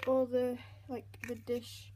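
normal talking voice

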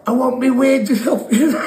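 high, loud, laughing whine; mock demand through laughter. Injured mate demanding wages, laughing mock whine. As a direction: squeal it while cracking up.

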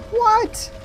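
shocked high squeak; startled, jump way up; surprised. Real, not acted. Battery low warning surprise.

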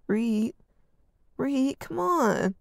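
pleading for attention; whined. Acted. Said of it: "Arden whined again".

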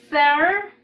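loud, drawn-out "Fair" with tongue between the teeth; "Fair" begun as a strong TH and held. Shown as a demonstration. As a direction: model "Fair" loudly, tongue out on the first sound. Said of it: "vamos a sacar la lengua".

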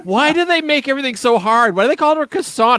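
a touch of a flustered reality contestant; exasperated rant. Acted. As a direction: rant like a fed-up cooking show guest. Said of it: "he sounds like one of Chef Ramsay's guests".

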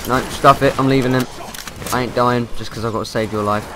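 ordinary talk, nothing marked

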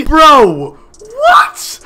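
high, loud stunned cry; shocked scream. Real, not acted. Hearing his brother propose to the girl, shocked shriek. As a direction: shriek it in total disbelief.

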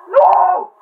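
high, very loud cry; dismayed scream. Real, not acted. Gamer losing the match, dismayed scream. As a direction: scream the refusal at the screen.

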